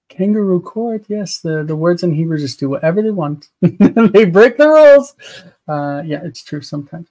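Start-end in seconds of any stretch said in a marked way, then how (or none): 3.5-5.2 laughing. Real, not acted. Laughing remark on Hebrew.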